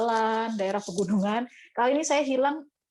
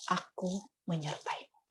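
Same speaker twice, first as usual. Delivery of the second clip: much softer, slow gentle promise; God reassuring her, soft and slow; soft reassurance; 2 semitones lower, 11 dB quieter than usual. Retold or imitated. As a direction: promise it quietly and slowly. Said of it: "Suara yang begitu lembut".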